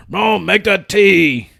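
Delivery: a loud, slow, drawn-out call from the kitchen; dad calling his son from the kitchen, slow and loud; deep-voiced Vietnamese dad. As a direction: call Rome slowly like a stern father. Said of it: "super deep-voiced Vietnamese man".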